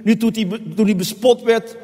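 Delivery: ordinary talk, nothing marked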